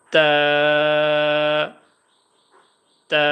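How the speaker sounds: Arabic ta held one second; held ta sound. Shown as a demonstration. Arabic teacher demonstrating the letter, a held ta. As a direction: stretch the ta for a full second. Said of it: "এক second টানবো".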